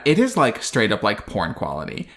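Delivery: usual speaking voice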